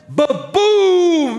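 loud, high drawn-out boom; explosion imitation. Preacher showing the chamber exploding, loud booming imitation. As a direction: burst out with a vocal blast.

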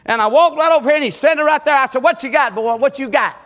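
high, loud revival storytelling; fervent preacher in full flow; preaching at full force. Acted. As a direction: drive the story home to the congregation. Preacher retells the boy's news.

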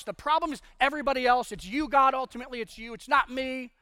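high, loud, fast blaming; blaming whiner. Acted. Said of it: "then to just blame everybody else".

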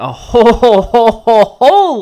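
WHOLE repeated over and over, loud and high; hammered repetition. Real, not acted. Host hyping a pile of news, loud repeated WHOLE.

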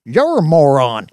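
loud, high oafish comeback; retorting Brick. Acted. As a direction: throw the insult back as the deputy. Brick throws the insult back.

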